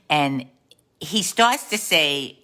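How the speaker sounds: ordinary delivery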